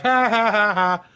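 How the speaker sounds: high, spelt-out taunting cackle; acted gloating laugh at pursuers; mocking laugh. Mocking laugh of fleeing Ronnie.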